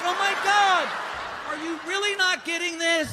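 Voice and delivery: high, loud exasperated yell; hammed-up exasperation, high and loud; exasperated shout. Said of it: "I'm like".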